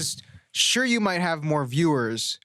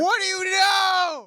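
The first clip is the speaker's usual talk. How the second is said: very high, drawn-out holler; over-the-top interrogator losing it; mock interrogation yell; 3 dB louder, slower than usual. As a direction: scream the question slowly at a suspect. Mock interrogation at two-way mirror.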